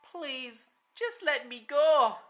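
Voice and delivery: high, desperate plea; begging. Acted. Captured Douglas begging Grim Jim, high and desperate. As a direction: beg to be let go.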